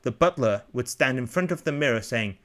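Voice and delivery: usual speaking voice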